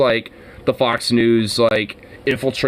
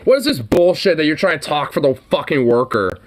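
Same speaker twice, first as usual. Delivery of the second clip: ordinary delivery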